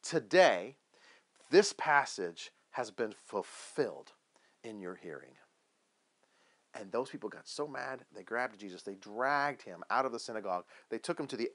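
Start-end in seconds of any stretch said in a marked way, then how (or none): 0.0-5.3 slow, deliberate, a pause after each phrase; very clear declaration. Acted. Jesus turning to those looking at him, very clear and deliberate. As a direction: spell it out phrase by phrase, dropping away at the end. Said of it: "he says very clearly".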